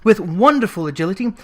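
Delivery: ordinary delivery, nothing marked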